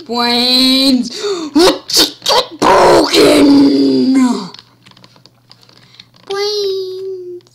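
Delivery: loud, slow zombie roar. Pretend. Zombie yelling.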